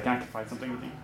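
dropping the voice to share a secret; hushed confiding. Retold or imitated. Officer leans in to confide in the student, hushed. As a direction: lean in and ask it softly, like a secret.

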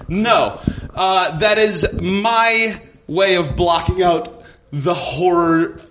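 ordinary talk, nothing marked

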